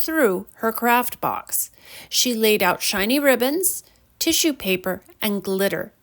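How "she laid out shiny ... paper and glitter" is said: plain reading voice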